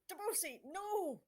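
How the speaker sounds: sharp, high cry to stop a shot; alarmed shout. Acted. Bowie shouting to stop Debussy shooting, alarmed high cry. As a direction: yell at him to stop before he fires.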